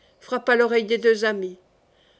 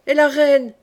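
loud, higher anxious shout; shouted question; 4 semitones higher, 7 dB louder than usual. A narrator's reading. La Mole calls after fleeing de Mouy, shouting. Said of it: "cria la Mole".

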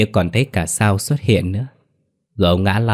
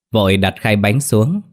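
plain reading voice

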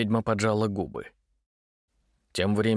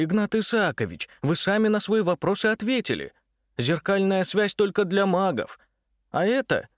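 excited; 9 semitones higher than usual. A narrator's reading. Митя argues eagerly.

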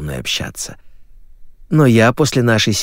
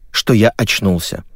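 plain reading voice

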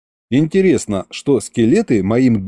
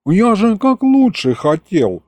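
grumbling imp; 7 semitones higher than usual. A narrator's reading. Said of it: "забурчал".

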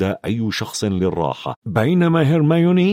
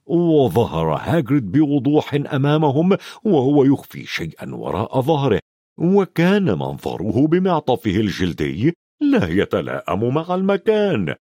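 plain reading voice

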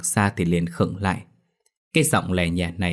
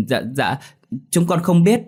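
frightened answer; 10 semitones higher, 4 dB louder than usual. A narrator's reading.

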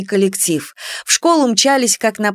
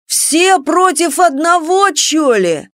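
high, drawn-out protest; indignant singsong; 8 semitones higher, slower than usual. A narrator's reading. Прыщ protests at being ganged up on, indignant singsong. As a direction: chant the complaint slowly, outraged. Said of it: "нараспев возмутился Прыщ".

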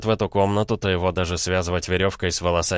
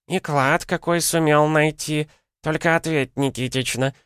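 high-pitched granny airing a grievance; бранящаяся старуха; 7 semitones higher than usual. A narrator's reading. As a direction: complain indignantly in an old woman's high voice. Old women quarrelling over the knife. (возмущённая старуха, высоким голосом)

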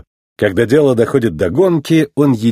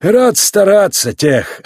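hearty champion; 8 semitones higher, much slower than usual. A narrator's reading. Champion answering his owner's thanks.

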